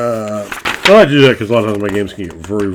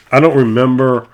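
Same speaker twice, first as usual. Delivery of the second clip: ordinary talk, nothing marked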